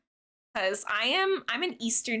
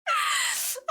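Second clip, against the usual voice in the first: squealing laugh. Real, not acted. Laughing at her own quirk.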